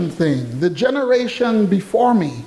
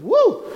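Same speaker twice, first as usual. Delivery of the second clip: very high, short "woo"; celebratory whoop. Real, not acted. Preacher cheering an auntie nearing a hundred, high whoop. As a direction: whoop for someone's milestone.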